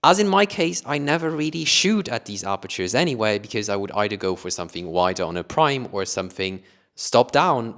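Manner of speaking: ordinary talk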